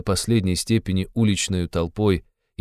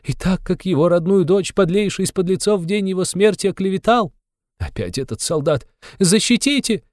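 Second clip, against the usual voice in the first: Katerina Ivanovna crying out; 11 semitones higher than usual. A narrator's reading. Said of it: "закричала она чиновнику". (высокий отчаянный крик вдовы)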